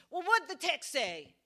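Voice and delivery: loud, sharp demand. Retold or imitated. Angry woman demanding the text, loud. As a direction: press for the answer.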